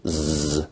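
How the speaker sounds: "z" as a held, buzzing sound; voiced z. Shown as a demonstration. Teacher contrasting two sounds, "z" as a long voiced buzz. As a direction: sustain "z" with vocal cord vibration. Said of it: "so it's a voiced sound".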